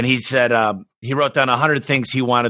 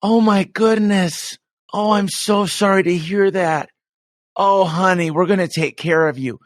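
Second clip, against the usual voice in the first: mom soothing a caller; 8 semitones higher than usual. Retold or imitated. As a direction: coo like a mom comforting a stranded customer. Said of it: "she'd go".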